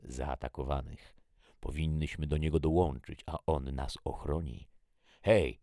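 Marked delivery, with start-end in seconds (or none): 5.2-5.6 loud, higher hail; shout. A narrator's reading. Man hailing two girls from down the street, shouted. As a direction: call out to get their attention. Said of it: "krzyknął mężczyzna".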